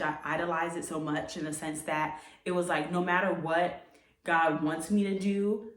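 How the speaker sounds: usual speaking voice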